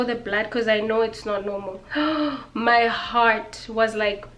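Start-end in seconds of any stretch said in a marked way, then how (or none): 1.9-2.5 a quick, high intake of breath in shock; sharp gasp. Retold or imitated. Telling viewers she saw the blood, gasping in shock. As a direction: gasp as if seeing it again.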